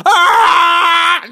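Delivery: high loud cry of terror; terrified scream. Acted. A fleeing islander screams in terror. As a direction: let out a panicked scream.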